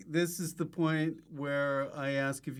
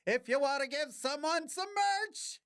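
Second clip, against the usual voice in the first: much higher, sustained mock-hype voice; silly merch plug; 12 semitones higher than usual. Host plugging merch to listeners, silly high hype. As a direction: push the pitch up high and hold it.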